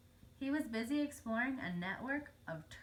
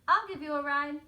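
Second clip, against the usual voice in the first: bright, much louder eager offer; cheery offer; 5 semitones higher, 10 dB louder than usual. A narrator's reading. Franklin eagerly offering Snail a ride, loud and cheerful. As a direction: sing it out like a keen helper. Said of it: "said Franklin".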